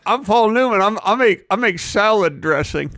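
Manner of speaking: high voice, upper teeth bared; fake teeth. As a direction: talk with teeth showing. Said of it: "are you wearing fake teeth".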